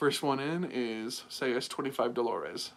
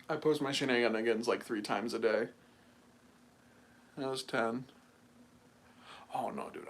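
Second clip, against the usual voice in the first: normal talking voice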